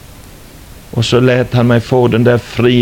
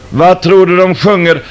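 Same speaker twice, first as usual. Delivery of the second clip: higher and louder, pressing the question; building excitement; 5 semitones higher, 4 dB louder than usual. Acted. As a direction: push the question to a peak. Rhetorical question built up. (stegrad, ivrig fråga)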